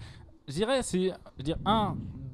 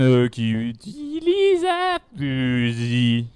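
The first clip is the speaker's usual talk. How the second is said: extremely deep bass voice; 4 semitones lower, much slower than usual. Pretend. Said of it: "la voix la plus grave".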